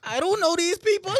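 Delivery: disbelieving outburst, pitch shooting up; exasperated. Real, not acted. To his cohost about a stranger's funeral, high exasperated protest. Baffled by unknown relative's funeral.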